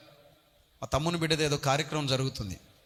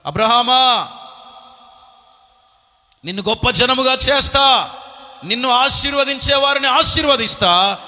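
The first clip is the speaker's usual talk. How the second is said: booming high voice of God proclaiming; 9 semitones higher, 12 dB louder than usual. Acted. God promising Abraham, booming and exalted. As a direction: thunder it out as God speaking to Abraham. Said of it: "అబ్రహాముకు దేవుడిచ్చిన వాగ్దానం".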